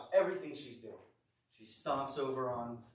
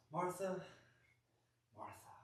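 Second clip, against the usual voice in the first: higher first call, hushed second; gently played teacher calling a name, with a long pause; Jesus; 7 semitones higher, 4 dB quieter than usual. Jesus answering Martha's complaint, softly acted patient call. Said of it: "answers in the most Jesus way possible".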